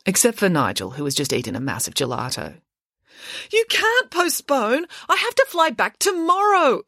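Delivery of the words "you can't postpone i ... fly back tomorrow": put-on high, slow, indignant girl's voice; exclaiming teen girl. Mirabella protests to the teachers, high and indignant. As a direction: protest like a spoiled schoolgirl. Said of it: "exclaimed Mirabella".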